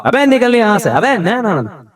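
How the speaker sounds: a high, hammed-up rude yell; mock angry outburst. Imagined rude principal throwing a teacher out, mock angry. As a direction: play the rude boss you are not. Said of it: "ان کا style change نہیں ہوگا".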